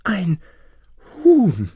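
high, loud exclamation, word by word; astonished wife. Acted. Wife hears of the hen, high and amazed. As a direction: break it in two with amazement.

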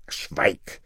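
scharf geflüstert; flüstere den Befehl streng; geflüsterter Befehl. A narrator's reading. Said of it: "befahl der König". (flüsternder Befehl)

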